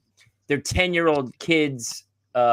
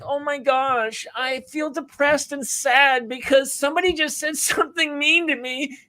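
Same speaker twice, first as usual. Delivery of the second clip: mocking a wounded, oversensitive child; mock hurt feelings; 11 semitones higher than usual. Kid upset by a mean comment, mock hurt. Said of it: "they're gonna feel like".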